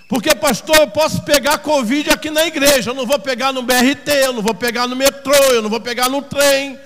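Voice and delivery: loud, driving sermon delivery; fervent preaching. Acted. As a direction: preach it hard, stretching the key phrases.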